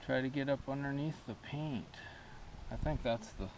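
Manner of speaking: talking while holding breath; strained words on held breath. Real, not acted. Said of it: "I'm holding my breath".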